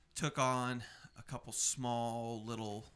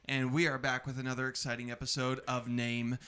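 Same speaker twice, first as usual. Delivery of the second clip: ringmaster; 2 semitones higher, 7 dB louder than usual. Acted. Host intro announcement.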